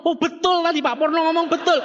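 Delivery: high-pitched sycophantic approval; mock eager agreement. RT neighbours agree with the successful man, mock fawning. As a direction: agree too readily, pitched well up.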